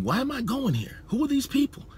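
high-pitched mock bewilderment; puzzled questioning. Visiting preacher asking God about his trip, high puzzled questioning. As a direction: pitch it way up, imitating someone baffled.

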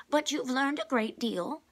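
high, loud protest from number two. A narrator's reading. Number two protests to Mister Benedict, high and loud. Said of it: "protested number two".

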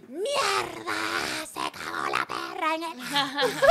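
high, loud yell, stretched out; retold shouting. Telling friends about the recorded audio, retold shouting. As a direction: re-enact yelling in outrage at the dog. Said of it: "estoy así gritándole".

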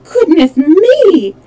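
high, loud, slow exclamation; alarmed cry in a child character's voice; trembling alarm. A narrator's reading. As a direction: draw it out in fright. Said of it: "trembling a little".

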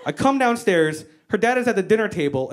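ordinary delivery

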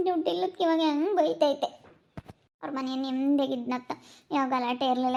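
low, soft, dejected murmuring; tearful moping. Acted. Scolded daughter alone lamenting her mother's temper, tearful moping. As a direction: grumble sadly to yourself, voice sunk. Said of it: "ಯಾಕೆ ಅಳ್ತಾ ಇದೀಯಾ".

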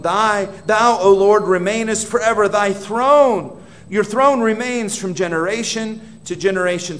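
proclaiming scripture. Acted. Preacher declaring God's throne to his congregation, full voice. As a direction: preach the verse out over the room.

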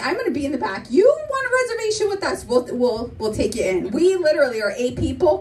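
ordinary talk, nothing marked

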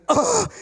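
high-pitched "ugh" of dread; fed-up, high groan; exasperated groan. Acted. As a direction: groan at the thought of it. Dreading ranting and partying.

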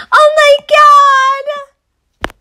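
gasping squeal, high and loud; excited gasp. Real, not acted. Spotting a dressed-up character, a high gasping squeal. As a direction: gasp with delight at a find.

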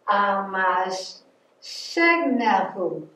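Amashegnehu stretched out very slowly, syllable by syllable; very slow sounding out. Shown as a demonstration. Teacher slowly repeating the hard Amashegnehu for children. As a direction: drag out Amashegnehu slowly so kids can copy. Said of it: "That's a toughie".

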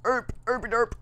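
goofy high nonsense babble; silly derp noise. Pretend. Gamer babbling a goofy derp at the screen. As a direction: blurt out a dopey derp.

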